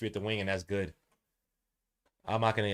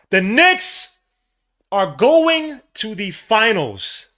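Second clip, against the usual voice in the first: ecstatic shout; 10 semitones higher, 12 dB louder, much slower than usual. Real, not acted. Knicks clinch the finals.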